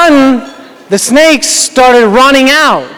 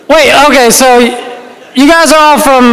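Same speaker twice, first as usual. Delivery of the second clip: normal talking voice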